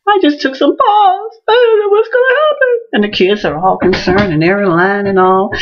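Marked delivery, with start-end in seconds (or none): 0.1-2.8 very high, frantic plea; retold high panic; panicked. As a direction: squeal it in a panic. Panicking to the nurse.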